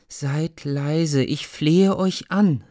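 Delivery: a mother's slow frightened entreaty; pleading mother. Acted. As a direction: beg them to hush, fearful. Mother begs children to hush.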